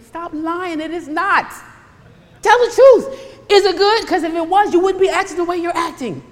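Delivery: high, loud challenge; calling out. Real, not acted. Pastor challenging listeners to tell the truth, loud. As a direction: call them out on the lie.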